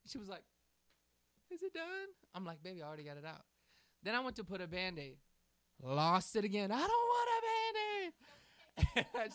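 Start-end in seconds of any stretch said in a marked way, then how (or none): 1.5-2.1 put-on much higher small child's question; timid little girl. Scared child asks after removal. 2.6-3.4 softer, gentle daddy reassurance; calm the little one down gently. Retold or imitated. Father reassures daughter. 6.7-9.3 put-on high, loud, slow wailing child with a laugh; whiny little girl. Child refuses bandage, laughing retell.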